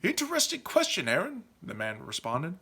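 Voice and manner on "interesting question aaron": high, softer musing voice; intrigued knight leader. Acted. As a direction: muse on it with lordly interest. Leader's character voice.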